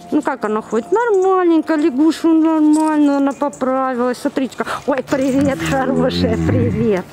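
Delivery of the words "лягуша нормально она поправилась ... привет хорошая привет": ordinary delivery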